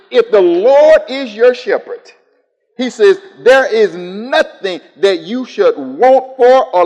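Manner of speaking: high, loud, slow preaching; preaching at full force. Acted. Preacher expounding the psalm to church, loud and slow. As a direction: preach each phrase of the psalm out.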